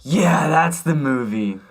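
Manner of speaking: slow and drawn-out; slow, exasperated. Retold or imitated. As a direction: drag it out in disbelief. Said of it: "And I'm like".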